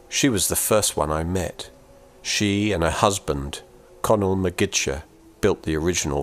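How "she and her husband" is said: plain reading voice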